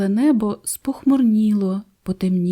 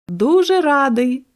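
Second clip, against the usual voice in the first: laughing father; 6 semitones higher, 5 dB louder than usual. A narrator's reading. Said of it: "засміявся чижик тато".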